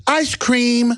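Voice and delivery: high, delighted little voice; mock childlike glee. Mocking Buffett holding ice cream with a high, childlike voice. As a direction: coo it like a happy kid. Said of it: "And he goes".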